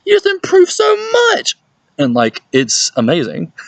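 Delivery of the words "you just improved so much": very high, loud astonished squeal; over-the-top high-pitched astonishment; amazed reaction. Retold or imitated. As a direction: shriek it in mock amazement. Acted amazed reaction to improvement.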